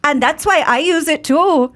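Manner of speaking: a hammed-up Irish accent, pitched high; mock Irish accent. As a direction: ham up a stage Irish leprechaun. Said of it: "Let's hear it, [name]".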